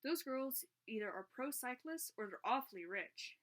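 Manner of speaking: ordinary delivery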